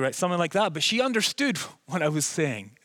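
ordinary delivery

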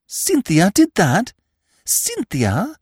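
high, drawn-out astonished question; incredulous. A narrator's reading. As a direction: question it in high-pitched disbelief. Eve cannot believe it.

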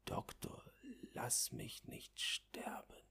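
tonlos geflüstert, langsam und leise; Flüstern. Acted. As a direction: flehend ins Ohr hauchen. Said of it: "flüstert mir ins Ohr". (der kranke Junge fleht den Arzt flüsternd an)

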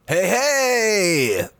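high excited call; excited shout. Real, not acted. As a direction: jump in to grab attention. Excited call over others.

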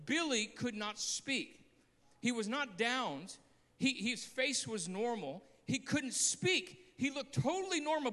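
usual speaking voice

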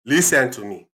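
mock-scolding African accent, emphatic openings. Dad tells off giggling listener, African accent. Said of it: "African accent".

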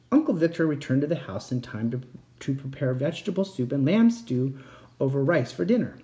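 usual speaking voice

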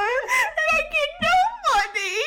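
high, loud outraged cry; ranting shout. Real, not acted. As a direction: cry it out indignant. Ranting about server wage.

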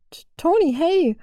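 high, stammered happy greeting; delighted call. A narrator's reading. Violet greets Tony across the hall, delighted. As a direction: pipe up in glad surprise. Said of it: "rief Violet erfreut".

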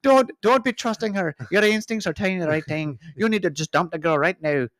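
high-pitched, hammed-up Irish baboon; Irish accent. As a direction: urge it in a squeaky Irish accent. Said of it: "the Irish baboons".

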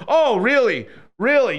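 high, loud sarcastic disbelief; mock surprise. Streamer ridiculing expats who came back, loud sarcastic surprise. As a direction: pounce on it with scornful fake amazement. Mocks returnees' change of heart.

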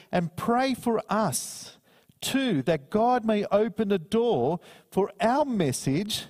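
slow scripture reading. Acted. Preacher reading Colossians to the congregation, slow and solemn. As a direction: read it like holy text from the pulpit.

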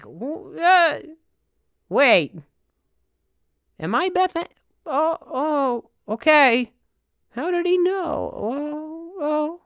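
put-on high, wavering old lady voice; confused old lady. Old lady wondering if she is Beth Ann, high and bewildered. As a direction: play a baffled granny. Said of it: "She's like".

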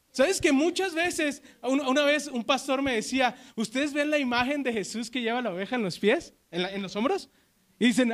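loud, emphatic preaching; preaching at full force. Acted. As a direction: drive the point home to the congregation. Preaching loudly about sheep. (predicación enérgica y fuerte)

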